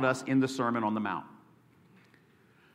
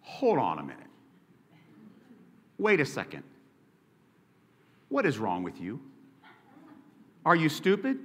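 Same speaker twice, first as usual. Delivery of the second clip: baiting; 6 dB quieter than usual. Retold or imitated. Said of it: "let me translate that for you".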